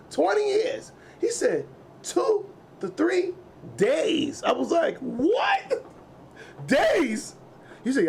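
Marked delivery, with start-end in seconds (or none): 0.1-0.9 much higher mock answer; imagine dad saying it. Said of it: "I thought he was gonna say". 2.0-4.4 much higher, slow dad answer. Retold or imitated. Dad voiced in story. 5.0-7.4 much higher, shocked what; disbelieving outcry. Retold or imitated. Shocked reaction in story.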